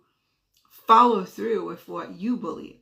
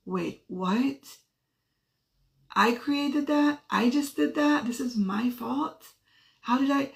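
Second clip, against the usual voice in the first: high-pitched questioning, slowly dawning; stunned disbelief; 4 semitones higher, slower than usual. Retold or imitated. Mom realizing the blame is hers, stunned disbelief. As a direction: retell it as incredulous self-questioning.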